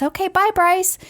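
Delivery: high sing-song farewell; sing-song goodbye. Retold or imitated. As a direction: wave goodbye in a lilting voice. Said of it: "she says".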